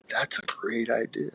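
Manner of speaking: breathless whispered reply. Retold or imitated. Lifter answering God's advice, whispered. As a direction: hush it like a secret realization.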